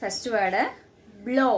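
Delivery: ordinary delivery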